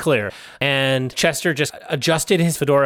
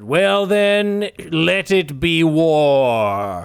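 mock-epic, drawn out very slowly; retold war declaration; 2 semitones higher, much slower than usual. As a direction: proclaim it grandly, stretching the last words. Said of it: "And he said".

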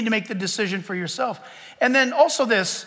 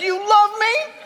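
whiny pleading; 10 semitones higher than usual. Acted. Spouse asking again, laughing.

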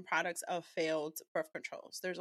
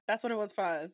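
ordinary talk, nothing marked

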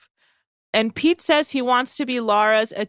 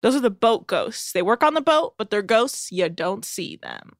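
ordinary delivery

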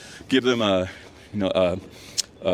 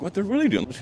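excited curiosity; 6 semitones higher, faster than usual. Retold or imitated. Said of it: "We're like".

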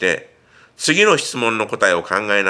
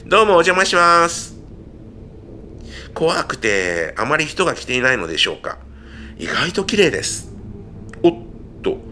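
higher, perky reporter delivery; 4 semitones higher than usual. Acted. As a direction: play a lively TV reporter. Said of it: "ビデオのリポーターのように". (テレビリポーター風の声)

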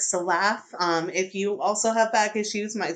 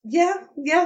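incredulous; 10 semitones higher, 5 dB louder, slower than usual. Real, not acted. Reacting to odd remark.

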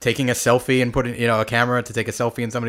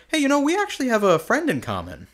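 high eager voice; 12 semitones higher than usual. Retold or imitated. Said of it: "I was like".

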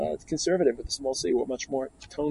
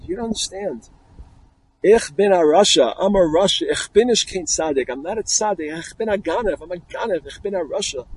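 higher-pitched plea, starting loud and then easing; retold tearful confession; 4 semitones higher, 6 dB louder than usual. Thief confesses to the young Rebbe, imitated tearful plea. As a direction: act out a crying thief's anguished confession. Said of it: "the thief, starts crying".

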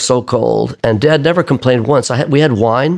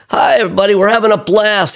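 put-on higher, cheery old-man voice for the toast; imitating old dad; 9 semitones higher than usual. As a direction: lightly mimic a 105-year-old dad raising his glass. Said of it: "say hello to everybody".

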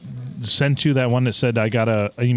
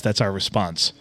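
ordinary talk, nothing marked

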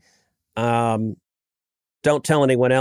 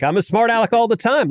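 mock indignant; 11 semitones higher, 4 dB louder than usual. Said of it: "I'm like".

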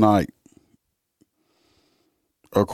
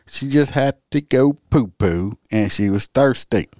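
mocking baby talk; 4 semitones higher than usual. Mocking the suspect's excuse.

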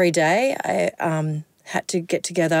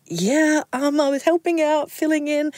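nervous, high; 9 semitones higher than usual. Retold or imitated. To the CEO, a nervous high reply. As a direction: voice up high, tentative and small.

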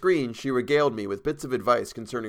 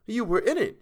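much higher playful voice; teasing; 12 semitones higher than usual. A narrator's reading. Model teases painter, much higher playful voice. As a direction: needle him playfully.

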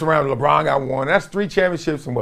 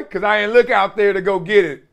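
ordinary talk, nothing marked

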